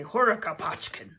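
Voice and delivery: a light mock Russian accent on the name; playful Russian accent for a grandchild. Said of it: "we kinda really go off on an accent with that".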